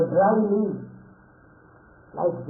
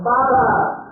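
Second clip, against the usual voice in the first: calling out; 5 semitones higher, 6 dB louder than usual. Acted. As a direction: call Baba higher and louder through the window. Calling father through window.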